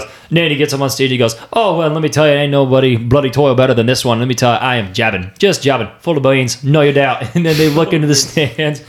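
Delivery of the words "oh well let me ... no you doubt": lightly put-on Australian accent, quick and chatty; a hint of an Aussie anchor hamming it up. Friend retelling a movie scene mimics the Australian anchor, mild Australian accent. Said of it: "the Australian news anchor".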